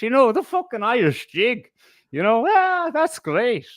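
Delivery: mock Irish accent. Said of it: "what accent was that?".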